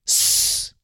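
a hard whispered shush; stern shush. Retold or imitated. Man hushes his girlfriend, a stern shush. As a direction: silence her like a child. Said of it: "así bien serio".

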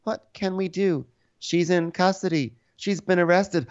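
high, hammed-up helpless voice; theatrical despair; mock distraught reply. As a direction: lament it in a high put-on voice. Acting out distraught Whitney.

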